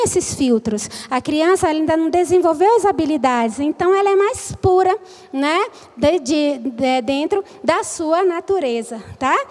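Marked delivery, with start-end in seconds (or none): none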